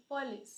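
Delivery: पुलिस with just a half a, puh-LIS; half a sound. Shown as a demonstration. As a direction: keep the first vowel of पुलिस tiny and short. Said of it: "बिल्कुल आधा अ की sound आ रही है".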